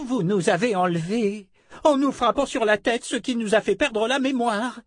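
put-on very high old-woman voice; accusing old woman. (vieille femme accusatrice, voix très aiguë)

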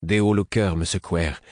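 ordinary delivery, nothing marked

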